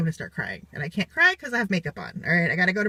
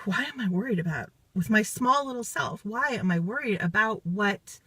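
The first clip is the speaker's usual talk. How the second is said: normal talking voice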